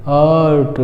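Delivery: आर्ट with long aa and no r; teacher modelling आर्ट; silent r. Shown as a demonstration. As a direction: say आर्ट as "aat", dropping the r. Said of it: "कैसे?".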